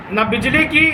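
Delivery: ordinary delivery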